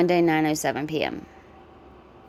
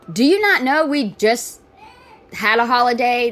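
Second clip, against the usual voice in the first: much higher and louder, calling nonsense; exasperated, pitched-up rant; incredulous; 4 semitones higher, 4 dB louder than usual. Real, not acted. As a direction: challenge her in disbelief. Disbelieving rebuttal to the video.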